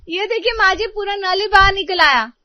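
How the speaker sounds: high, loud distressed cry; daughter-in-law shows the tap, upset and loud. Acted. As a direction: call out in alarm over the broken tap.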